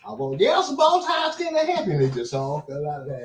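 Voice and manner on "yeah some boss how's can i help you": high, loud, hammed-up yes-sir voice, near a shout; mock eager servant. Answering the boss who called him over, with a loud, high, mock eager servant voice. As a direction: play the overly eager helper, calling out high.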